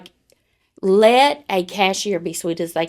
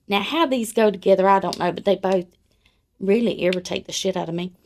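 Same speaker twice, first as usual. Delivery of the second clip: ordinary delivery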